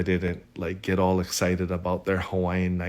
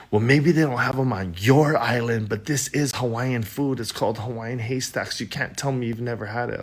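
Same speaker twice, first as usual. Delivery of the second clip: imitating an irritated supervisor, higher-pitched; retold huffy insistence, pitched up; 4 semitones higher than usual. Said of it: "She's irritated".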